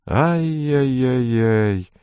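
slow drawn-out tut-tut; mock tutting. A narrator's reading. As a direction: stretch out a mocking ai-yai-yai.

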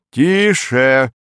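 secretary calling the room to order. A narrator's reading. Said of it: "повысив голос". (повысив голос, призывая к тишине)

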